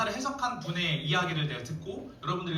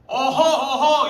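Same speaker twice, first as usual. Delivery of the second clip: scolding tut; 7 semitones higher, 10 dB louder, much slower than usual. Retold or imitated. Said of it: "누나가 삼촌을 혼냅니다".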